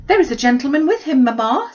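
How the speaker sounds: put-on high, loud eager girl's cry; excited girl. Kitty at the window to mamma, high and excited. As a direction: blurt it out like an excited young girl.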